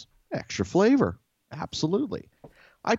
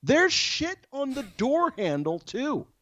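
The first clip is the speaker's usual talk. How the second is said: high, slow, horrified exclamation; appalled disbelief; slower than usual. Real, not acted. Telling Dan about the door handle, high appalled outrage. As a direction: spell out the horror, pitched way up.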